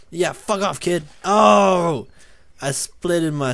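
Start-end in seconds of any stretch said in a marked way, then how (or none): 1.2-2.1 loud, high "oh" of pain; sudden raised yelp; pained yell. Pretend. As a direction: cry out as if struck. Hit in the head in game.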